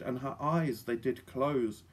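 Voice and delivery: plain reading voice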